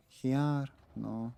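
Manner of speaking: ordinary delivery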